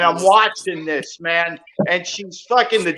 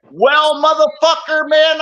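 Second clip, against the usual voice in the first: retold angry challenge; 7 semitones higher, 4 dB louder than usual. Confronting the silent husband.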